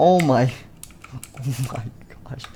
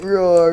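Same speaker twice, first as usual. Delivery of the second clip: put-on a kid's toy-monster roar, pitched up; mock monster roar; 6 semitones higher, 4 dB louder than usual. Kid voicing Nightmare Fredbear's roar as a mock roar. Monster animatronic roaring in story.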